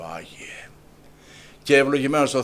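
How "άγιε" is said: breathy whisper of Άγιε; whispered holy name. Acted. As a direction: let Άγιε fall to a whisper. Prayer ends hushed.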